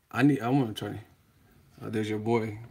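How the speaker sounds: normal talking voice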